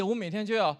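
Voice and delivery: ordinary delivery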